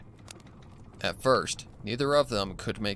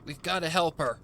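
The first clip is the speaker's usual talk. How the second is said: urgent; 7 semitones higher than usual. Acted. Urgent rescue.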